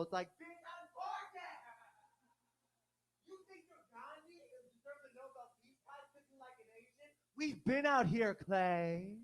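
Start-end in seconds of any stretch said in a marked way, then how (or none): 0.4-7.1 high, soft put-on falsetto; hammed-up high-pitched retort; mock indignant comeback. Acted comeback to the flirting. 7.4-9.2 loud, high drawn-out holler; hammed-up shouted punchline; mock outraged yell. Climax of acted comeback.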